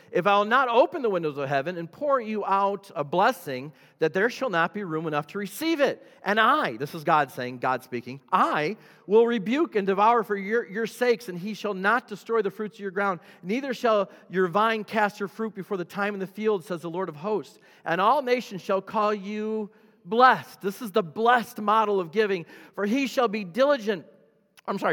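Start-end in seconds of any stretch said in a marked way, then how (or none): 0.1-6.8 loud, high preaching voice; forceful scripture proclamation. Real, not acted. Preacher reading Malachi to the church, loud and ringing. 8.3-24.1 loud, high preaching voice; forceful scripture proclamation. Real, not acted. Preacher reading Malachi to the church, loud and ringing.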